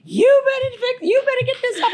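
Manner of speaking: high, loud mock scolding; squeaky indignant husband voice; husband demanding she fix the gum; imitating husband. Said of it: "he's like".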